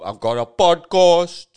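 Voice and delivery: podcast said as "pɑdkɑs", flat Cape Town vowels, slow and loud; flat Cape Town accent. Shown as a demonstration. Comic showing off his Cape Town accent, slow and loud. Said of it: "It's a very Cape Townian thing".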